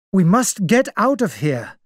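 a touch of anger, pushing to escape; angrily. A narrator's reading. Doctor insists they flee, mildly angry. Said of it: "Doctor Armstrong said angrily".

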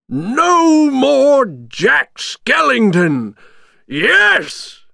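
very high, drawn-out triumphant yell; happy shout. A narrator's reading. Villain celebrating his rival's end, gleeful shout. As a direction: crow it loud and high, slowly. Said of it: "he shouted happily".

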